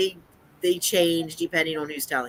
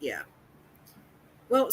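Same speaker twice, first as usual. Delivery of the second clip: ordinary talk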